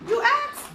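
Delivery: high, rising "you ask?"; questioning refrain. Acted.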